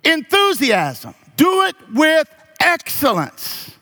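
high, drawn-out preacher's call; rising preaching. Acted. Pastor urging the church to work with enthusiasm, preaching high. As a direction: lift it up to the congregation.

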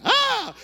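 huge high loud yelp of fright; scream. Retold or imitated. Jumping out of bed in the quake, screaming. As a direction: yelp like the earthquake just hit. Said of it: "jumped up and went".

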